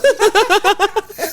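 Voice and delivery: loud high-pitched burst of laughter; real loud laugh. Real, not acted. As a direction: let a big laugh out. Big laugh after jeer.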